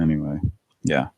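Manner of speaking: usual speaking voice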